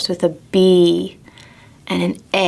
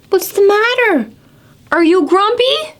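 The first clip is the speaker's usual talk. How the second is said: higher, concerned voice; concerned Jim; 11 semitones higher than usual. Acted. Jim asking slumped Norman, higher concerned. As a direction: ask gently worried.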